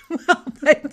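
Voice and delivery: laughing through the word, high; a bursting laugh on the opener. Real, not acted. Embarrassed friend laughing at herself, high giggly laugh.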